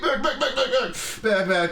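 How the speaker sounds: high laughing back back back; laughing squeals. Acted. Comic voicing the overwhelmed audience, laughing and squealing.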